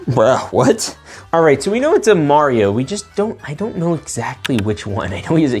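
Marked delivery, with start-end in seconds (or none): none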